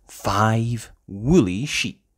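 usual speaking voice

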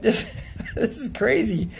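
high, giggling it's crazy; laughing disbelief. Retold or imitated. Recalling hours of chanting, high laughing disbelief. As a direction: laugh at how absurd it is. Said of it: "And I thought".

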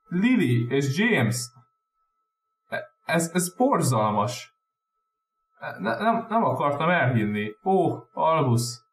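high, slow, stammering horror; gasping disbelief that breaks into stammers; shocked dismay. A narrator's reading. McGonagall hearing of the Potters' deaths, shocked and stammering. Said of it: "döbbenten hőkölt hátra".

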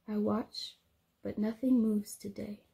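normal talking voice

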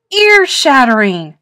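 loud, thrilled howl; a ghoul girl howling praise. Acted. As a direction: let out a cheering howl. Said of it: "howled Wilma".

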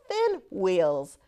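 plain reading voice

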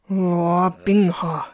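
name forced out through clenched teeth; tightly restrained anger, jaw locked. A narrator's reading. Master warns his disciple after being touched, through clenched teeth. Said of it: "he said through clenched teeth".

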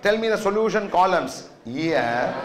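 ordinary talk, nothing marked

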